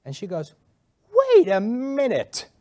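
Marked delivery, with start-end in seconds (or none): 1.1-2.5 sudden recognition. Retold or imitated. Woman suddenly recognizing him.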